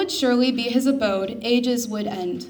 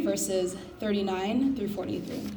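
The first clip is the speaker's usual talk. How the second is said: ordinary talk, nothing marked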